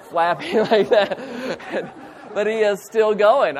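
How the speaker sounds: laughing as you describe it. Real, not acted. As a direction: laugh your way through the picture. Laughing at flapping snake jaws.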